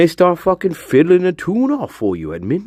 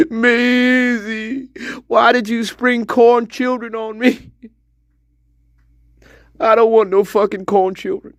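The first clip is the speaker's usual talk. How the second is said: whiny hillbilly; 9 semitones higher than usual. Acted. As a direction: gripe like a backwoods husband. Hillbilly character complains.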